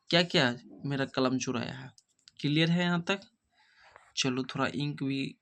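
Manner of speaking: ordinary delivery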